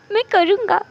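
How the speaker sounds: put-on high, giggly child voice; gleeful little child. Five-year-old promising mother mischief, gleeful.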